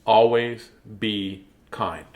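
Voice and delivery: "Always be kind" slow, a pause after each word; word-by-word motto. Shown as a demonstration. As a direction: spell out "Always be kind" one deliberate word at a time. Spells out the A B K motto.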